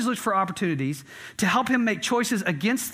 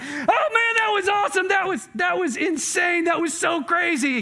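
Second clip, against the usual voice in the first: thrilled riders shouting; 11 semitones higher than usual. Retold or imitated. Riders stepping off the coaster, shouting with excitement. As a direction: shout it like you just got off the ride. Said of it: "And they're like".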